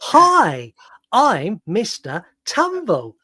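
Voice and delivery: put-on high, drawn-out creepy kids' presenter; creepy Mister Tumble. Host does his creepy Mister Tumble for co-host, high and slow. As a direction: greet like a too-cheery kids' TV host. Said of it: "really creepy impression of Mister Tumble".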